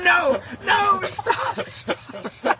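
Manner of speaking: high, frantic cries of stop; panicking and yelling at the tractor driver to stop. Retold or imitated. Yelling at the farmer's son to stop pulling, frantic. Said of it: "And I'm going".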